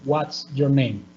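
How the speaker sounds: slow "What's your name?" dropping on "name"; measured WH-question, "name" falling at the end; falling intonation. Shown as a demonstration. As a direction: let "name" glide down to a close.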